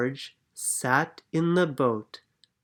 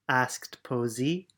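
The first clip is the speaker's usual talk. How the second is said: ordinary delivery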